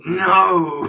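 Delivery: sudden loud high cry of no; freaked-out shout. Retold or imitated. Gamer freaking out at the job offer, loud panicked no. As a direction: blurt it out in panic. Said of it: "I thought, I freaked out".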